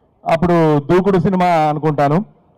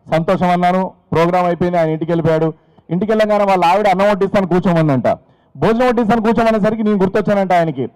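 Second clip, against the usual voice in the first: ordinary delivery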